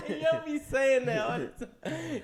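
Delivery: high-pitched, helpless laughing speech. Real, not acted. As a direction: talk through a big laugh. Cracks up at co-host's remark.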